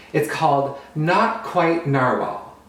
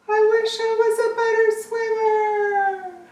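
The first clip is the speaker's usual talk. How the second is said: much higher, wistful small-creature voice; little narwhal voice; slower than usual. Acted. Young narwhal swept off by the current, wishful high voice. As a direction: sigh the wish as a tiny narwhal. Kelp wishes while swept away.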